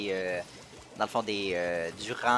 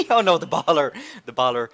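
laughing alarm; 6 semitones higher, 8 dB louder than usual. Real, not acted. Blocked by an enemy baller.

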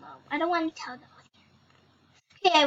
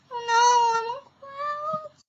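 whiny dismay; 7 semitones higher than usual. Pretend. Player stuck on clouds in the game, whining in dismay. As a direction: start in alarm, shrink to a high little whine.

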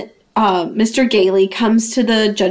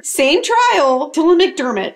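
ordinary talk, nothing marked

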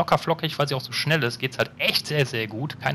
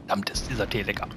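whispered, softer, savouring the tea; 4 semitones lower, 6 dB quieter than usual. Real, not acted. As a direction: breathe it out after a sip. Savouring tea after a sip. (geflüstert und genießerisch)

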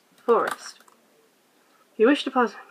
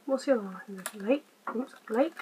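ordinary delivery

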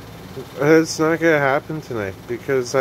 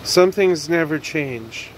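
normal talking voice

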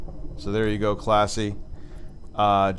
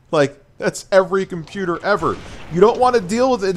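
higher and louder, exasperated rant; angry rant; 6 semitones higher, 3 dB louder than usual. Real, not acted. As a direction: vent at him, getting more fed up. Ranting at a reviewer's complaint.

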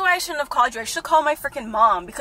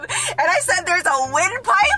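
slow, laugh breaking into the words; 4 semitones higher, slower than usual. Real, not acted. Prankster recounting own silly lie, laughing. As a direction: crack up while saying it.

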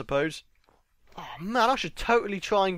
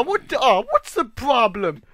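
ordinary delivery

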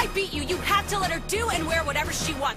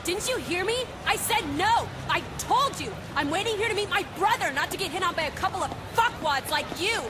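high-pitched, heated refusal; indignant, riled-up rejection; irate rebuff; 7 semitones higher than usual. Acted. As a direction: tell them off sharply, voice pitched up. Rebuffing men hitting on her.

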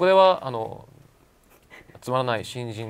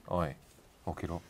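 quiet, menacing low growl; low menacing mutter; 6 semitones lower, 11 dB quieter than usual. Shown as a demonstration. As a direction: growl it softly to wake them. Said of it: "朝眠いっていう時に".

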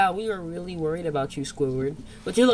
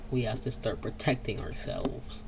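unvoiced scared whisper; 7 semitones lower, 9 dB quieter than usual. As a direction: whisper so the monster can't hear. Hiding from monster, whispering.

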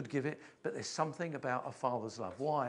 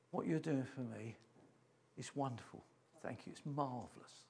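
quiet, moved father; 2 semitones lower, 7 dB quieter than usual. Retold or imitated. Dying father thanks son.

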